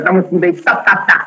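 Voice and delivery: usual speaking voice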